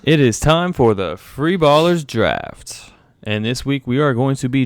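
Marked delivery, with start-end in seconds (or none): 0.0-2.9 slow, higher, booming hype read; big announcer voice. Acted. Host reannouncing the draft even bigger, hammed-up announcer. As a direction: stretch it out like a stadium announcer. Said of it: "repeats announcement bigger".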